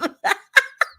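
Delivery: high, breathy laugh out of breath; breathless laugh. Real, not acted. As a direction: laugh while catching your breath. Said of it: "I'm out of breath".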